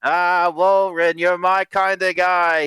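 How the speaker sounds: high, drawn-out pleased voice; high-pitched, affectionate exclamation; delighted cook. Acted. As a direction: gush fondly at a favorite customer. Cook delighted by praise of soup.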